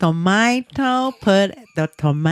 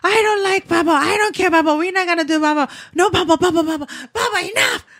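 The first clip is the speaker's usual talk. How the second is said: higher, mock exasperated rant; retold frustrated outburst; 9 semitones higher, much faster than usual. Tired mother retelling snapping at her baby, mock exasperated. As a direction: blurt it out, fed up and comic. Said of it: "I said".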